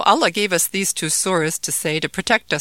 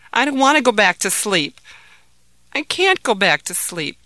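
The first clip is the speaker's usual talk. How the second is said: high, louder anxious refusal; upset child; 4 semitones higher, 5 dB louder than usual. Acted. Frightened boy refuses to go back to sleep, insistent.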